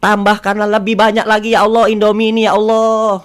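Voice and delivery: long wailing "Ya Allah", full voice; shouted prayer. Retold or imitated. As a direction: imitate an old woman crying out in prayer. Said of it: "dia, dia teriak".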